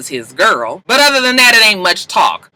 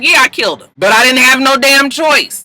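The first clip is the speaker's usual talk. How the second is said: higher, louder acted-out self-defense; defensive confession; 9 semitones higher, 6 dB louder than usual. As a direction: protest it emphatically, as if pleading your case. Retold confession, defending himself.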